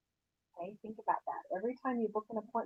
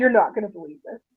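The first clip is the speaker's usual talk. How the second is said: louder teasing buildup; excited gossip; 10 dB louder than usual. Shown as a demonstration. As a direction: tease the big news. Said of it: "it sounds like I have a, gossip".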